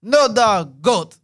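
loud, high mock Haitian accent; booming put-on Haitian dad voice. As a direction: imitate a Haitian father laying down orders. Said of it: "My dad was like".

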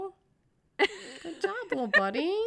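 put-on high, cooing praise like to a toddler; baby talk. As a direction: talk to them like a proud parent to a kid. Praising partner like a child.

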